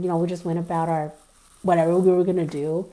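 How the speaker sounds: ordinary talk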